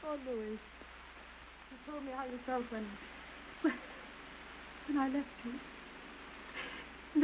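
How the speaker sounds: very slow, soft, faltering; almost whisper it, breaking off; hushed, halting. Acted. Wife reminding husband of his grief, hushed and halting.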